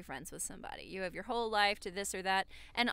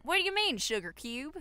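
Applejack attempt; 6 semitones higher, 4 dB louder than usual. Host attempts Applejack, co-host says it sounds like Apple Bloom. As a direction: go for Applejack but land too high. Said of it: "That's not Applejack".

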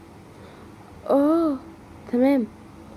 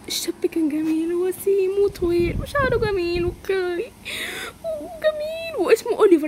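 slow, soft, lovestruck gush; dreamy swooning; 7 semitones higher, 4 dB quieter, slower than usual. Acted. Girl daydreaming about Oliver, slow and swooning. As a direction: melt while describing him.

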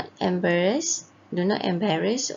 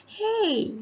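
acted outcry; 13 semitones higher than usual. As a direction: call out as if just robbed, in play. Story character's cap gets stolen.

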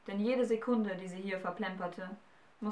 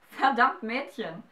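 loud laughing outburst; suddenly laughs at her nerve; laughing burst; 4 semitones higher, 8 dB louder, slower than usual. A narrator's reading. The giant bursts out laughing at the bold girl. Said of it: "lachte er dann plötzlich".

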